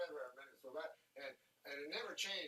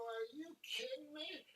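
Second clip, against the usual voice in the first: retold indignant rant, higher; mock outrage; 5 semitones higher than usual. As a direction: play up the outrage at the cop. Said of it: "I go".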